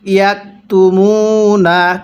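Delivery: slow, drawn-out tajweed chanting; slow Quran recitation. Shown as a demonstration. Teacher demonstrating recitation to students, slow chant. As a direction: recite each syllable long and measured. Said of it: "কিভাবে শিখব?".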